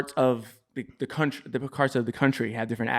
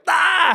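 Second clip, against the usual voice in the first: high-pitched "ah" of realization; mock outcry; 4 dB louder than usual. Son catching dad using his word, mock outcry. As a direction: cry out as if it suddenly clicks.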